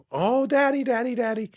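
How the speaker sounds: high-pitched gushing daughter voice; adoring little girl. Retold or imitated. Daughters fawning over their dad, adoring little girl. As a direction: squeal it sweetly like a doting little daughter.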